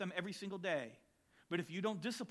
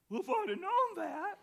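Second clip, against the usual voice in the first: much higher, amused through a laugh; laughing quip; 13 semitones higher than usual. Retold or imitated. Boy answering dad's hug, laughing quip. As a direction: toss it off laughing. Punchline of spanking story.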